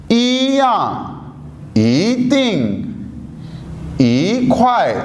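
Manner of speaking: ई या, ई तिंग, ई ख्वाए each with rising ई, falling second word; three tone examples. Shown as a demonstration. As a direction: recite the three examples slowly. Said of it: "तीन examples दी हैं".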